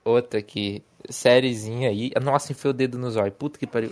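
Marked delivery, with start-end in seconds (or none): none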